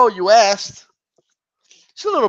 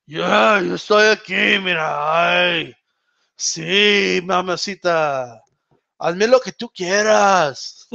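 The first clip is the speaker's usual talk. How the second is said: put-on slow, drawn-out drunken Spanish; drunk Chavez Junior; slower than usual. Streamer mimicking the boxer's drunken voice, slow slurred impression. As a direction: imitate a drunk boxer, stretching the word endings. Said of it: "When he's drunk, he's like".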